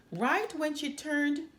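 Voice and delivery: ordinary delivery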